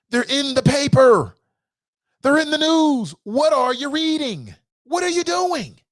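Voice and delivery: high, exasperated cries; worked up, emotional. Real, not acted. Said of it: "I'm getting emotional".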